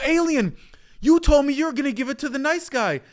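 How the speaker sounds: high, loud betrayed cry to the alien; outraged plea. Acted. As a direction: yell it up at him, cheated and desperate. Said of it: "And you go".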